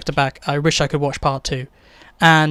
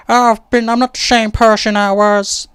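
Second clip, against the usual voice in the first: lightly put-on Southern accent, a bit higher; a half-hearted stab at a Southern accent; Maggie in a southern accent; 4 semitones higher than usual. Reviewer mimicking the character holding a gun, in a mock Southern accent. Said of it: "That's my meant to be southern accent".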